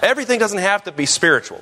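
ordinary talk

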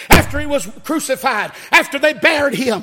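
high, loud preaching that builds to a shout; building sermon climax. Real, not acted. Preacher telling church how Jesus came back, fervent building proclamation.

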